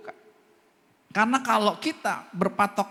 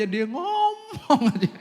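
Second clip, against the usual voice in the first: embarrassed laugh; 8 semitones higher, 4 dB louder, much slower than usual. Retold or imitated. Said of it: "Anaknya yang nyeletuk".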